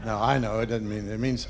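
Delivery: ordinary talk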